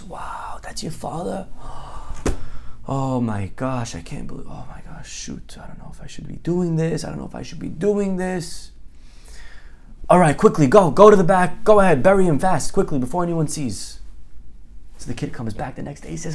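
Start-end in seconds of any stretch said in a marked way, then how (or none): 0.0-1.5 soft, whispered amazement; hushed awe. Acted. Friend learns whose son knocks, hushed awe. 1.5-2.8 long stage gasp; acted shocked gasp. Friend takes in the request, shocked gasp. 2.9-8.7 anxious dithering. Acted. Friend debates burying the body, anxious hush. 10.1-14.0 loud, pressing rush of orders; urgent hurrying. Acted. Friend orders the kid to bury it, urgent.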